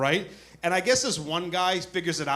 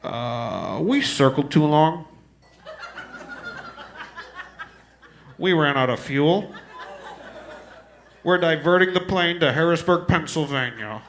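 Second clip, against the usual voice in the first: pilot voice; 2 semitones lower, slower than usual. Retold or imitated. Pilot announcing fuel ran out.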